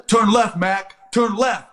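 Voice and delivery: high, urgent call to the driver; commander yelling directions. Retold or imitated. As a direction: call out orders to a driver over the noise. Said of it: "he's cussing me out".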